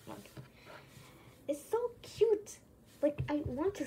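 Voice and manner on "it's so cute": high, slow squeal of delight; gushing cute. Real, not acted. Kid gushing at her toy, squealing it's cute.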